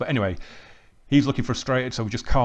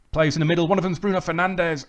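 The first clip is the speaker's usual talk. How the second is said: higher and louder call of the threat; sudden alarm; 7 semitones higher, 5 dB louder than usual. Real, not acted. Danger in the box.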